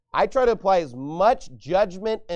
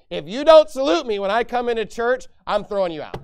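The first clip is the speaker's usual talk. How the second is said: louder and higher, a hammed-up furious demand; mock angry pastor; 5 semitones higher, 7 dB louder than usual. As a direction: play the petty pastor barking orders.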